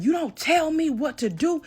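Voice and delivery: retold yelling. Ex yelling at the narrator who told him to stop, high mock yell. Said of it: "starts yelling".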